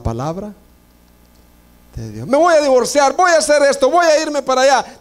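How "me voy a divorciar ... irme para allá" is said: high, headstrong mimicry; mocking a rash decision. Preacher imitating a believer set on divorcing, high and headstrong. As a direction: jump way up as someone who won't listen. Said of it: "quoting a rash believer".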